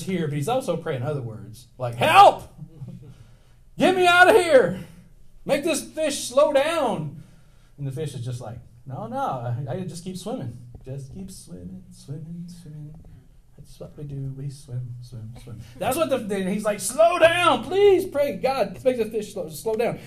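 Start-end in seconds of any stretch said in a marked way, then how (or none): 1.9-7.2 high, loud desperate scream, slowing at the end; mock terrified screaming; panicked cry for help. Jonah crying out in fish. 8.8-15.6 soft dreamy fish voice, fading away; a hint of a dopey fish humming along; the fish brushing Jonah off softly; lightly voiced fish. Fish answers, sing-song swimming. 16.8-20.0 high, loud slow begging; mock panicked plea; desperate pleading. Jonah begging God.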